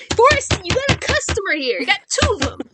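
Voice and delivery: high, loud shout for service; demanding holler. Acted. Ignored customer to bartender, demanding and loud.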